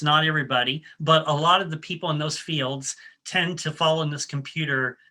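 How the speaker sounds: usual speaking voice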